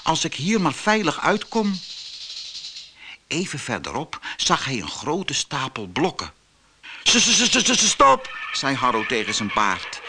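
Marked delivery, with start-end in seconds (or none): 0.0-1.8 higher, mildly anxious voice; understated fear, raised pitch; frightened thought. A narrator's reading. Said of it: "dacht Harro bang". 7.0-8.4 high stammered halt command; high-pitched, urgent stutter. A narrator's reading. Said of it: "Harro stotterde".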